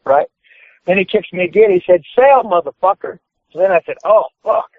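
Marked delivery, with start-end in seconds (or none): 2.1-3.2 high imitated command; insist, acting out the opponent; retold insistent order. Opponent ordering the rookie after a kick, imitated insistence. 4.0-4.7 high mock exclamation; retold pained reaction. Rookie kicked in the ring, mock pained exclamation.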